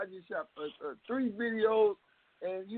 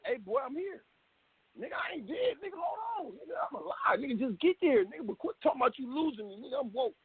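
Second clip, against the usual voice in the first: high-pitched, insistent plea; indignant high-voiced retort; urgent protest; 8 semitones higher than usual. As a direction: protest that you are still alive, pitched well up. Acting out his protest to ambulance man.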